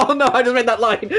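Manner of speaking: high, loud words through real laughter; cracking up. Real, not acted. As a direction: laugh helplessly while trying to speak. Cracks up at own reading.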